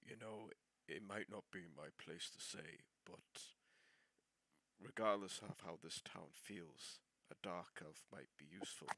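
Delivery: low, very soft conspiratorial whisper; hushed scheming aside to an ally; whispered in character. Acted. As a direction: murmur it secretly into his ear. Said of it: "I whisper into his ear".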